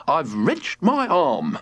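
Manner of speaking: slow, mildly pained grumble; a touch of wincing; hurt father. A narrator's reading. George hurt.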